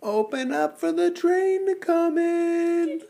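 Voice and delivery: high slow train game voice; high baby-talk voice. Acted. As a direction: lure the spoon in up high.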